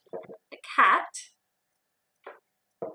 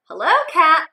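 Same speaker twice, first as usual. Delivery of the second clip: higher, louder hello; cheerful duck greeting; 6 semitones higher, 11 dB louder than usual. Acted. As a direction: call out to the cat.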